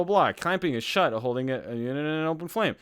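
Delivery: ordinary talk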